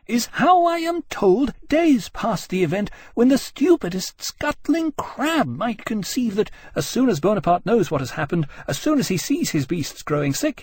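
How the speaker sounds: high-pitched, outraged tirade; shouting. Acted. Jane shouting at the commissioners, high and outraged. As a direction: rail at them indignantly. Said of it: "Jane was shouting".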